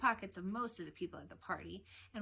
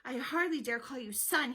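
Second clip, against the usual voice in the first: loud, angry rebuke, pitched up; furious father, acted in narration; angry growl; 5 semitones higher, 6 dB louder than usual. A narrator's reading. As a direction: growl it at a disappointing son. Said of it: "he growled".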